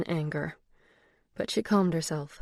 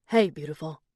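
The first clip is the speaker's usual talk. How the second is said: put-on Boston accent whisper; 3 dB louder than usual. A narrator's reading. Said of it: "a voice with a thick Boston accent whispered".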